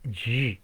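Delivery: G held as a long "dzh"; English J sound. Shown as a demonstration. Teacher spelling out English sounds, drawn-out J sound. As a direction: stretch G into a buzzing English J. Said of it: "la J es G".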